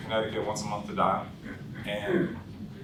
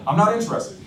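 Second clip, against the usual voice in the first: irritated brush-off; 10 semitones higher, 5 dB louder than usual. Acted. Annoyed prospect on the phone.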